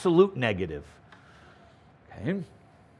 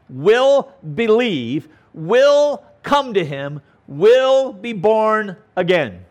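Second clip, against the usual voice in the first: slow, higher, deliberate; slow emphatic; 4 semitones higher, 4 dB louder, slower than usual. Shown as a demonstration. Teacher to class, slowly stressing each will. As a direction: lay each promise down slowly. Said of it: "Every individual who the Father".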